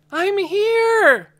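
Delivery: high full-lung yell. A narrator's reading. Lena yelling to Dune at the top of her lungs. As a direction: yell as loud as you can. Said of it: "she yelled at the top of her lungs".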